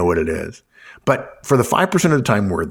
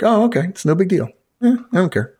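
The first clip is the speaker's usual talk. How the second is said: lightly mock-nonchalant, pitched up; feigned unconcern; 9 semitones higher than usual. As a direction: shrug it off as no big deal. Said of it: "you fake it a little bit".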